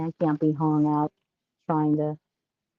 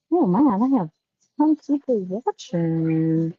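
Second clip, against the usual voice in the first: normal talking voice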